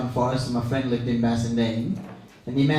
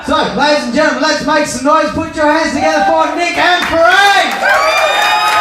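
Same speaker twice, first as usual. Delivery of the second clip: announcer shout; 14 semitones higher, 10 dB louder than usual. Real, not acted. MC brings a duo on stage, full-volume announcer shout. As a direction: whip up applause, stretching out the names. MC introduces the next act.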